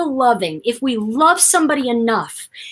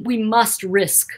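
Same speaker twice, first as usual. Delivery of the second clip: normal talking voice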